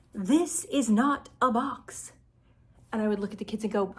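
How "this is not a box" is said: slow, higher, word-by-word denial; exaggerated storybook protest, each word separate; emphatic insistence. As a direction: spell it out firmly, "not" stressed. Reads the rabbit's growing insistence.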